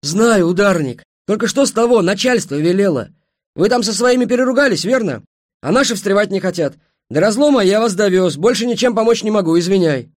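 loud, high, heated refusal; angry. A narrator's reading. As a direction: wash your hands of it angrily. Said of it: "Пегаса зло топнул ногой". (зло, громко и высоко)

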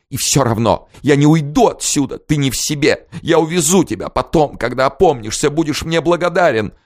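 high, emotional insistence; tearful insistence. A narrator's reading. Weeping princess insists she won't leave him, high. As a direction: refuse to leave, overwrought.

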